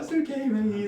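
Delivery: soothing. Retold or imitated. Soothing the dog in story.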